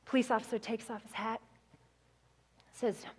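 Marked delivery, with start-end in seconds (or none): none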